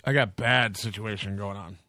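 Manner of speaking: runny nose, stuffed-up voice; snotty crying. Real, not acted. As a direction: speak through a runny nose. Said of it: "sorry, my nose is running".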